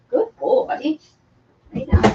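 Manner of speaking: praising the dog in a high coo; high pet talk. Real, not acted.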